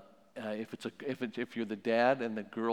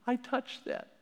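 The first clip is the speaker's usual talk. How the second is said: put-on much higher little child voice; child's voice; 13 semitones higher than usual. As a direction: answer like a small kid caught out. Child answering parent in role-play.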